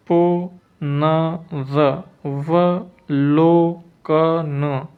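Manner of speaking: पुनरवलोकन very slowly, पु-न-र-व-लो-क-न; long, separated syllables in पुनरवलोकन; syllable-by-syllable dictation. Shown as a demonstration. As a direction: leave a gap after each syllable of पुनरवलोकन. Teacher dictates spelling syllable by syllable.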